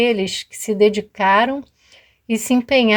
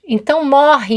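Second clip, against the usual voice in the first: furious sentence; 5 semitones higher, 6 dB louder than usual. Acted. Soldier condemns her.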